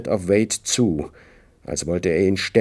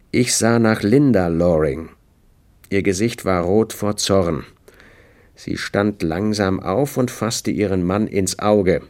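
usual speaking voice

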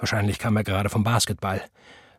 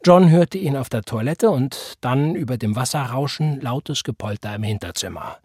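ordinary delivery, nothing marked

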